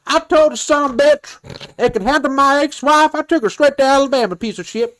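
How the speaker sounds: indignant, high-pitched country imitation. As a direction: rattle off a protest in a high put-on country twang. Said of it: "the country voice".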